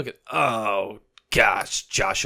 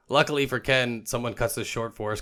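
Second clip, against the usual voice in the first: usual speaking voice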